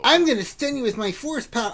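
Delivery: high-pitched puny hero voice; mock heroic squeak, deflating at the end; mocking character boast. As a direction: start high and boastful, then drop on the punchline. Mocking a weak game character.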